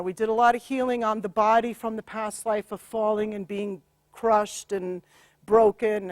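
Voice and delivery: ordinary talk, nothing marked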